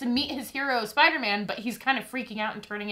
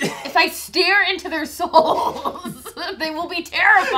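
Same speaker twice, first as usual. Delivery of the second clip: playful overdramatic threat, raised and loud; mock menace; 5 semitones higher, 7 dB louder, slower than usual. Joking with co-host about staring down viewers, mock menace. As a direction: ham it up, drawn out, breaking into laughter.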